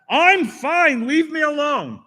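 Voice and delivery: high, loud "leave me alone"; irritated refusal. Retold or imitated. Old man on the bench sending the helper off, high and annoyed. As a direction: push the young man away.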